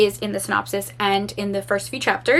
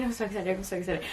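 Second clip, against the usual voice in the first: much softer, quick little repeats; hushed excitement; 3 semitones lower, 11 dB quieter, faster than usual. Real, not acted. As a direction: repeat it small and low, almost to yourself. Repeating her excitement under her breath.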